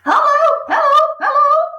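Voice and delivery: high, loud parrot hello; parrot calling. Acted. Parrot in the chimney calling down to Matilda, high and loud. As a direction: squawk it down the chimney. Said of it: "the bird called down to her".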